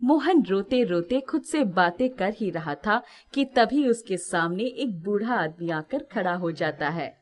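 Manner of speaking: ordinary delivery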